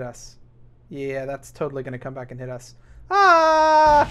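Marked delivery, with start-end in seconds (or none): 3.1-4.1 high, loud drawn-out "ah" yell; long loud scream. Real, not acted. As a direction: cry out high and hold it. Mock alarm as launched part returns.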